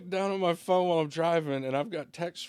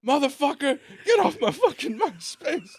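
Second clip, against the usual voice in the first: higher, louder mock rant at the roommate; retold angry yell; 10 semitones higher, 5 dB louder than usual. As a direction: bark it at the prankster. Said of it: "and was like".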